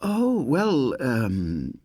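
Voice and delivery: put-on high, fluttering elderly voice; lightly voiced welcoming old lady; relieved old lady. A narrator's reading. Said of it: "she said".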